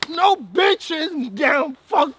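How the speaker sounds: slow, loud wailing complaint; real wailing frustration; loud lament. Real, not acted. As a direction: moan each word like it hurts. Lamenting loudly.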